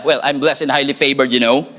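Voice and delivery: mock-confident brag with a laugh; sassy accent with attitude. Shown as a demonstration. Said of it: "With an accent, with an attitude, with an eyebrow".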